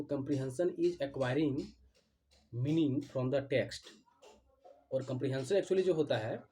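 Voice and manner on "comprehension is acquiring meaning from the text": slow dictation. Shown as a demonstration. Teacher dictating the definition to students, drawn-out and deliberate. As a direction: spell it out so the class can write it down.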